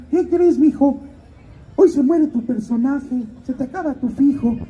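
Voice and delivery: high put-on godmother voice. Godmother telling her godson his character dies, in a high put-on voice. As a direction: break the news in a high-pitched caricature. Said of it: "me dice la madrina".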